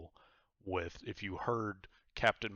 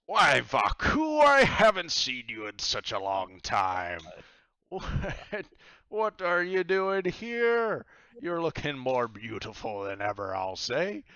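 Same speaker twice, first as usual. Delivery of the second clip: loud, high, hearty pirate bellow; booming pirate captain; 6 semitones higher, 12 dB louder than usual. Acted. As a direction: greet her like a jovial old sea dog. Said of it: "and he says".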